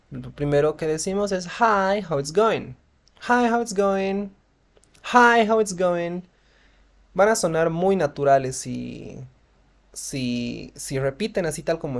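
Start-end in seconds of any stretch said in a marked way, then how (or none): 1.6-6.2 HI stretched high and loud, then "how it's going" easing down; natural friendly intonation. Shown as a demonstration. English teacher models a greeting for learners to repeat, natural friendly intonation. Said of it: "con la misma entonación".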